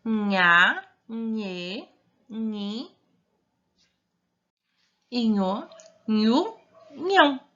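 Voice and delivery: nha, nhe, nhi, nho, nhu, nhão each said separately with a ny sound; syllable reading drill. Shown as a demonstration. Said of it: "Nós lemos". (nha, nhe, nhi, nho, nhu, nhão, sílabas bem separadas)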